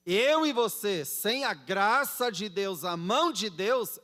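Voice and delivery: fervent pulpit delivery; emphatic preaching. Real, not acted. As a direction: drive each phrase home loudly to the congregation. Preaches at full force.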